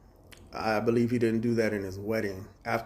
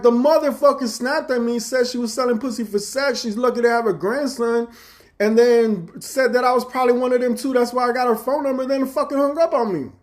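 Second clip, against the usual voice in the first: much higher, louder indignant rant; outraged retelling; 12 semitones higher, 8 dB louder than usual. Retold or imitated. Telling brother-in-law how the girl hung up, high loud rant. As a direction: spit it out, still outraged.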